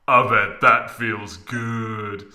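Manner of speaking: slow, drawn-out mock leer; a hint of a smug husband; lightly voiced Cloud. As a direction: Cloud teasing from behind, slow. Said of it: "said Cloud from behind her".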